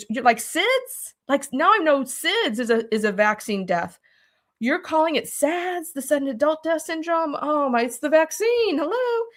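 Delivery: half-hearted yell, pitch up, no real volume; mock yell. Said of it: "I'm yelling".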